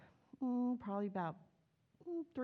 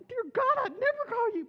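much higher and louder, hammed-up alarm; mock horror; 14 semitones higher, 8 dB louder than usual. Preacher recoiling from the judge, mock fright. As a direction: squeal it in mock terror. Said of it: "I'm like".

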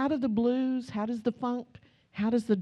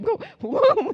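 very high, loud engine sound; vroom noise; 13 semitones higher, 7 dB louder than usual. Retold or imitated. Kids recalling the plane falling, very high vroom. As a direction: make the drop sound.

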